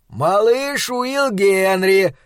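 slow, high glad shout; shouting over shoulder. Acted. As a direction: Flanagan shouts the boy's name over his shoulder. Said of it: "крикнул он через плечо".

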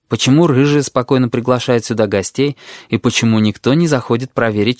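ordinary delivery

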